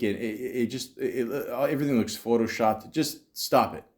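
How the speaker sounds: ordinary delivery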